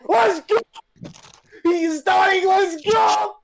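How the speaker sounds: high gleeful shouting; excited cheering. Real, not acted. Cheering friend eating pepper.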